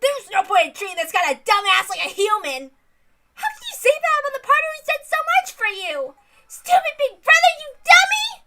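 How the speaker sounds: put-on a high-pitched young girl scolding her brother; a high girl voice, indignant and building to a shout. Reads a girl character scolding.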